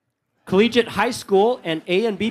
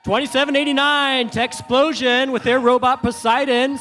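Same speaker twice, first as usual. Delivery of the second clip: higher, punchy team intro; announcer call; 4 semitones higher than usual. Real, not acted. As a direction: sell the team number to the crowd.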